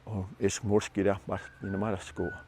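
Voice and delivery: low, very soft mumble in a made-up language; soft, indistinct foreign-sounding muttering; mumbling. Retold or imitated. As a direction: mumble it under your breath to an interpreter. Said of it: "he'll mumble to our linguist".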